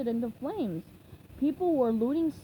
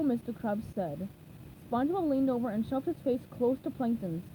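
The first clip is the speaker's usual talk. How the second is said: usual speaking voice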